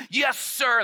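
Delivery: retold eager salute. Inmate to prison guard, eager loud salute. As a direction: snap to attention eagerly.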